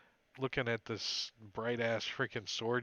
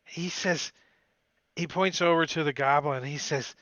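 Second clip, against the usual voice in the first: ordinary delivery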